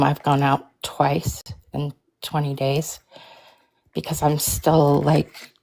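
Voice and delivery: ordinary delivery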